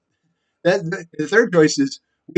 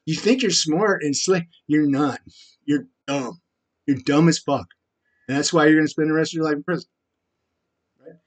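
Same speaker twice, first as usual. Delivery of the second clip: usual speaking voice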